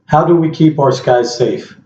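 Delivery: ordinary talk, nothing marked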